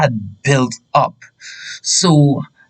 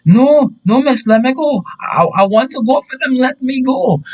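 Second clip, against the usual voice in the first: high, wailing plea to be released; re-enacted worked-up begging; hysterical protest; 10 semitones higher than usual. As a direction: protest frantically, pitched way up. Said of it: "hysterical in my emotions".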